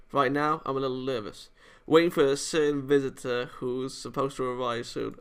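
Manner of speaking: usual speaking voice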